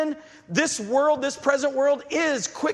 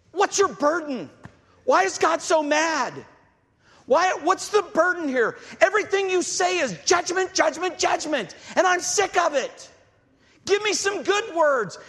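higher, whiny mocking voice of grumbling people; mocking complainers; 7 semitones higher than usual. Preacher mimics the people scoffing at God's burden, higher and mocking. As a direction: mimic them sneering at the prophet's message. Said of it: "It, they're mocking".

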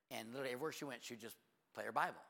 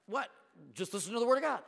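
loud, higher, exasperated retort; mock indignant outburst; 9 semitones higher, 11 dB louder than usual. Pastor mimicking a critic, loud mock indignation. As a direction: snap back like an offended churchgoer. Mocking a complainer's reply.

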